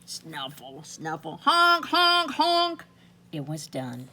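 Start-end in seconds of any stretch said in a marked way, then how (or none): 0.0-1.4 quiet low snuffling sniffs; soft snuffle. A narrator's reading. Boy sniffs. 1.4-2.8 much higher, louder honks; loud nose honking. A narrator's reading. Boy blows his nose.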